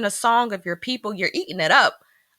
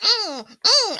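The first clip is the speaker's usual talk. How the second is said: high laughing mm-mm of delight; playful high mmm; mock bratty mmm. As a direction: savour it like a spoiled kid. Mocking bratty eating noise.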